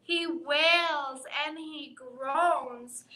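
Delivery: ordinary delivery, nothing marked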